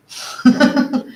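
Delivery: quick airless chuckle; a short breathy laugh at the joke. Real, not acted. Painter laughing at the Bangles copyright joke, breathy chuckle.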